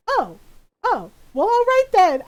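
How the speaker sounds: comic loud backing down, climbing high; Donna Noble. Retold or imitated. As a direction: back off with exaggerated, rising acceptance. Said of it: "And she's like".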